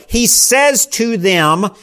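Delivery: says drawn out with a slow rise; stress on says. Shown as a demonstration. A preacher stressing the present tense for the church, says drawn out. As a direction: lean on says to make it present. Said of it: "Matthew's emphasis".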